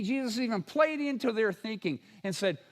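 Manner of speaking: ordinary delivery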